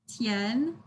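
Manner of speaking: TIAN rising in pitch; second tone. Shown as a demonstration. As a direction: lift "tian" upward like a question. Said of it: "it's the four tones".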